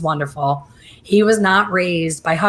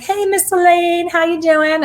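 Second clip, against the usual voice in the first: high, sing-out cheery hello; big high-pitched welcome, imitated; happy man's greeting; 12 semitones higher, 4 dB louder than usual. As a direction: imitate a delighted man greeting a favorite. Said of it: "happiest little man you've ever met".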